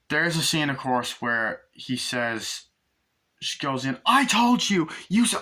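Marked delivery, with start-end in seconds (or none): 4.1-5.3 high, loud retold rant; pitched-up, raised voice of accusation; mock angry outburst. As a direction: act out a furious accusation. Acted outburst from horror film scene.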